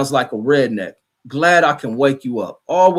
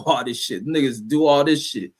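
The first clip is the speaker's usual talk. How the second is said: ordinary talk, nothing marked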